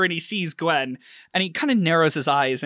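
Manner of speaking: normal talking voice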